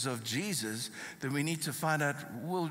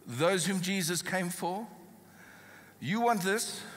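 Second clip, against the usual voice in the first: ordinary talk, nothing marked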